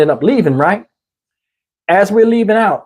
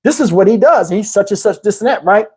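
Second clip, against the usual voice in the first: ordinary delivery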